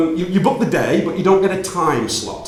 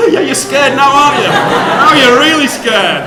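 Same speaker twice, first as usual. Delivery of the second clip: louder, slowing teasing taunt at the audience; 8 semitones higher, 8 dB louder than usual. Real, not acted. Speaker teases the audience after revealing Pauline is his mother, gleeful.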